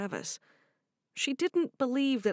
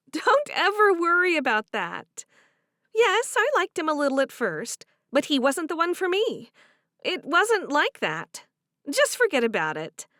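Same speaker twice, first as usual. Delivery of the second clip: higher, warm voiced heroine; 7 semitones higher, 6 dB louder than usual. Cherish offering to do the dishes, higher and bright. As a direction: speak up brightly to her friend.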